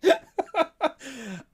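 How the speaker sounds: high burst of real laughter. Real, not acted. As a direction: let a big laugh out. Cracking up at the story.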